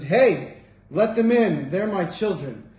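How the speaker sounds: loud call to let them in; booming fatherly order; commanding call. Retold or imitated. Hashem ordering his children let in, loud commanding call.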